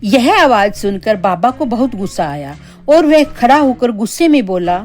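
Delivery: ordinary delivery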